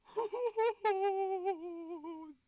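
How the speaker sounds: high, loud burst of laughter; hysterical laugh. Real, not acted. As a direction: laugh in disbelief. Laughing after the crash.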